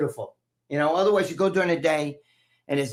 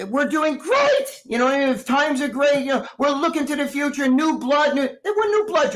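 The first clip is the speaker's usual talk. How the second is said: Kamala Harris impression; 9 semitones higher, 4 dB louder than usual. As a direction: draw it out like an upbeat campaign speech. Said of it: "Kamala Harris is gonna tell you tonight".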